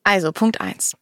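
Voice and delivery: normal talking voice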